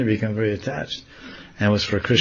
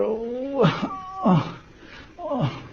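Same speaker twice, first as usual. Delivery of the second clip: high, soft acted "oh"; little high oohs of effort; straining "oh"s; 5 dB quieter, much slower than usual. Radharani on tiptoe after a flower, high straining oohs. Acting her reaching on tiptoe.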